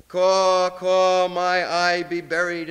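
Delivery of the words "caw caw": louder imitated crow call; crow caw. Poet cries like a crow.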